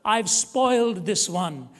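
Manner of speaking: loud, slowed plea; slow, loud plea in the poem. Shown as a demonstration. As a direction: stretch the line out slowly and loudly. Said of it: "this is the way the poem went".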